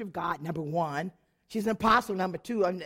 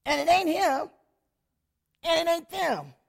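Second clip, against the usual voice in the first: higher and louder, punched; emphatic denial; 7 semitones higher, 5 dB louder than usual. Real, not acted. Telling the church the fault was not husband or leaders, emphatic. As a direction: hit each "ain't" hard.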